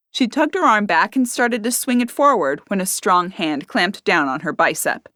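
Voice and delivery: ordinary delivery, nothing marked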